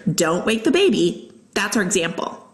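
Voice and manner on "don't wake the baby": ordinary delivery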